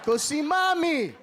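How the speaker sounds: put-on high, slow brush-off to the kid; dismissive shout. Dad shooing the ugly kid off to mommy, a loud brush-off. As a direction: shoo the kid away loudly.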